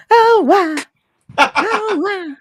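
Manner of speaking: very high squeaky chipmunk voice. Acted. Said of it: "You sound like a chipmunk".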